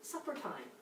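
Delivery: Supper time said low and soft, no edge; calm call. Retold or imitated. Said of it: "Instead, I go down and go".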